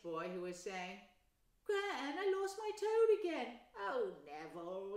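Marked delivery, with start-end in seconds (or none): 1.6-3.6 put-on high, loud upset boy; whining boy. Neville telling his gran he lost his toad, whiny. 3.8-5.0 put-on slow, sighing old gran; weary sigh. Gran sighing at Neville, slow and weary. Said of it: "he heard the old woman sigh".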